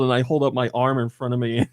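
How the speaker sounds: ordinary delivery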